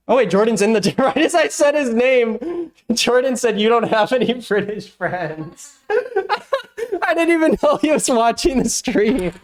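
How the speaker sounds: high, laughing surprise. Real, not acted. The streamer laughing as his friend turns up. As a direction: laugh through it.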